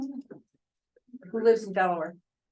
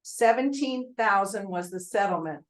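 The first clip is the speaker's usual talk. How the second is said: usual speaking voice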